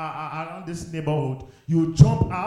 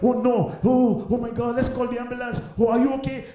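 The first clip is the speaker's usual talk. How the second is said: panicked bystander; 8 semitones higher than usual. Retold or imitated. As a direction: voice raised higher, alarmed and hurried. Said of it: "you're like".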